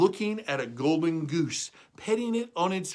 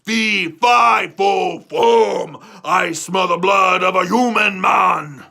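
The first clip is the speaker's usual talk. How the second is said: loud shouted giant chant; angry giant roar; 4 semitones higher, 9 dB louder, slower than usual. Acted. Angry giant shouting as he sniffs for Jack. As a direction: boom it out across the clouds. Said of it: "he looked angry".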